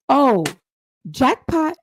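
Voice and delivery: high, pouncing "jackpot" reaction; gleeful discovery. Acted. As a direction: react like you just struck gold. Acts out her gleeful reaction.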